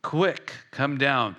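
QUICK high and set apart; urgent, emphatic order, QUICK punched out; emphatic command. Retold or imitated. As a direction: hit QUICK high, then come down. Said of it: "There's an emphasis that Jesus is saying".

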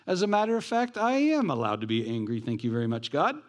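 high, indignant Jonah talking back to God; defiant retort. Retold or imitated. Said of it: "he says".

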